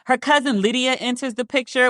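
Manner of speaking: ordinary talk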